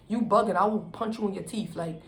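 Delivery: usual speaking voice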